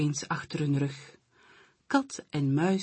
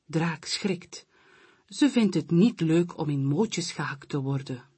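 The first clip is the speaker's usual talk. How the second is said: ordinary delivery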